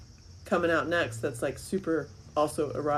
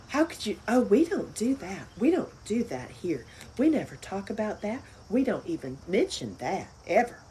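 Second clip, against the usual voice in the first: higher, prim pearl-clutching voice; mock the prudish church folk; hammed-up offended church lady; scandalized churchgoer; 3 semitones higher than usual.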